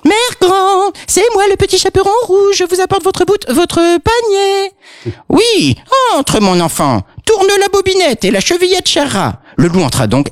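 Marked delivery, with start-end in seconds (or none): 0.1-4.7 very high falsetto maiden. Acted. Wolf at the door, faking a very high maiden voice. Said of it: "prit une voix de pucelle". 5.3-9.4 very high quavering granny; old granny voice. Acted. Grandmother calling the visitor in, very high old voice.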